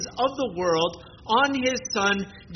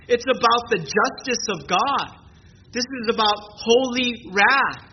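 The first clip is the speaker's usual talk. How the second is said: usual speaking voice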